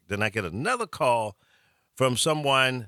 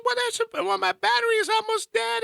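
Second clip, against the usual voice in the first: high falsetto sobbing wail, hammed-up; mock a panicked woman bawling down the phone; imitating hysterical crying. Scared passenger sobbing to her driver on the phone, high mock wail. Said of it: "it just boo-hooing".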